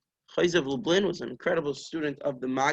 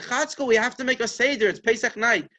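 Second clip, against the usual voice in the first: higher, pressing plea to wake up; urgent nagging; 6 semitones higher, 4 dB louder than usual. Retold or imitated. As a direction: imitate a wife urging her sleeping husband. Wife urging sleeping husband.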